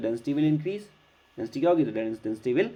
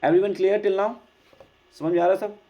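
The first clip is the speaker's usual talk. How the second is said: calling out to class; 6 semitones higher, 4 dB louder, faster than usual. Real, not acted. Teacher checks on the class.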